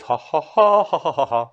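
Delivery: mock rap ad-lib chant; rhythmic rapper ad-lib; imitating a rapper's ha. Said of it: "there's some things where he goes".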